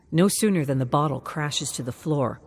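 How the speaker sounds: plain reading voice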